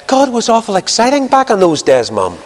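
put-on high-pitched little girl to her mum; wee girl. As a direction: imitate a small child, pitched well up. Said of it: "the wee girl looks up into her mother's face and said".

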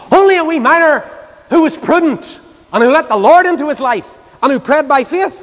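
high, emphatic pulpit delivery; fervent preaching. Real, not acted. Preacher extols the praying miner to congregation, fervent preaching. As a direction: ring out each clause with conviction. Same words repeated as raised preaching.

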